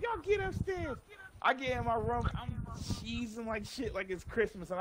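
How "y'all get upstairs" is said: high mother's bark; angry mom. Retold or imitated. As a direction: snap it like a fed-up mom. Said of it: "She's like".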